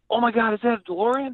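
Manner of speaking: high, gushing woman amazed at the car; a woman leaning out an SUV window, thrilled; excited stranger. Retold or imitated. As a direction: gasp it out like a stunned passerby. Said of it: "She goes".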